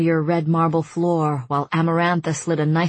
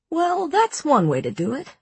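wry amusement; 10 semitones higher than usual. A narrator's reading. Wry remark after her vomiting.

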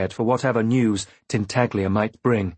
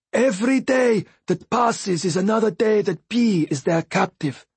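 higher, lighter voice for the Fool; Fool's voice; 10 semitones higher than usual. A narrator's reading. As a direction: voice him light and knowing.

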